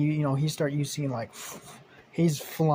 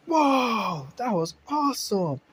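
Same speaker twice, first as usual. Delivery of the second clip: a high, amazed whoa; thrilled; 8 semitones higher, 3 dB louder, slower than usual. Retold or imitated. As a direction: gasp out with delight after the ride. Landing after a thrilling ride.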